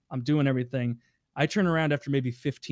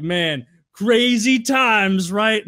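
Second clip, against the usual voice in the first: higher, louder and slow; imitating Andy; 6 semitones higher, 7 dB louder, much slower than usual. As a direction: savor the memory of crazy times. Andy recalling the trip.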